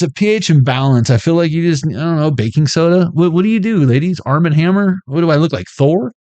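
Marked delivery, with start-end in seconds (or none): none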